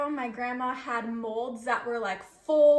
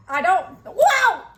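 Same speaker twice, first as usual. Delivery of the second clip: loud high startled wow; surprised; 6 semitones higher, 12 dB louder than usual. Real, not acted. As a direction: react to the mess in shock. Jello pours out of molds.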